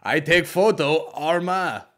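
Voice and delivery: slow, loud mock accent, cracking into a laugh; insisting in a thick Eastern European accent, laughing. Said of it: "he kept going like this".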